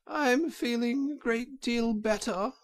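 high, faint put-on washerwoman voice; feeble voice. A narrator's reading. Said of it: "said Toad in a feeble voice".

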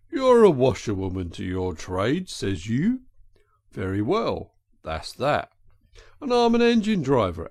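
put-on working-man's voice; good-natured engine driver. Driver offers a ride for washed shirts, good-natured. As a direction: talk like an engine driver striking a bargain.

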